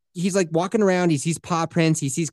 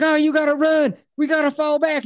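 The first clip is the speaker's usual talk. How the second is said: higher, urgent soldier warning; 11 semitones higher, 3 dB louder than usual. Retold or imitated. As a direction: warn him to run, high and pressing. Soldiers shouting in war retelling.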